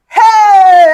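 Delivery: loud, high, long "hey"; jeering shout. Retold or imitated. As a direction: whoop like a mocking classroom. Class erupting at her answer.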